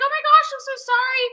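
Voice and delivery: high, fast gushed apology; gushing apology. Retold or imitated. Imitating Lauren calling her back, gushing apology. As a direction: rush it out high, imitating a friend.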